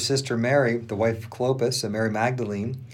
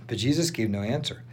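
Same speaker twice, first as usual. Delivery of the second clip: ordinary delivery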